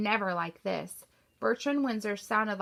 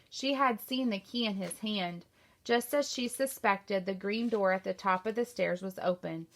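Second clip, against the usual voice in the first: plain reading voice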